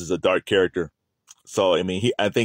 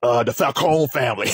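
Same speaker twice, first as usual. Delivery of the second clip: put-on New York gangster accent; 4 semitones higher than usual. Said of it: "it almost has a D M X feel to it".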